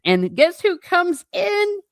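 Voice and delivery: ordinary delivery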